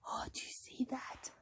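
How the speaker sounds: whispered gasp of wonder; hushed whisper. Acted. Storyteller shows child the picture, hushed whisper. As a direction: whisper it quickly after a gasp.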